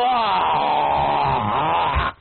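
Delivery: put-on low, grunting bear-like growl from a sulky kid; grizzly bear growl. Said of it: "They sound like a grizzly bear".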